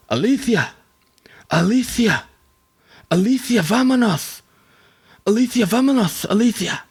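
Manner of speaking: high, lisped calls of Alicia; mock Castilian lisp. Javier calling out to his lover with a mock lisp. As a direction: call out to a lover in a hammed-up lisping Spanish voice. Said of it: "they have a little lisp".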